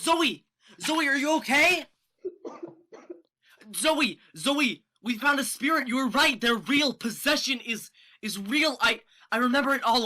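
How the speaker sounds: loud, high, overjoyed rush; loud joyful shouting to a friend; excited shouting. Acted. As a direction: burst with relief and excitement. Friend returns, Alex overjoyed.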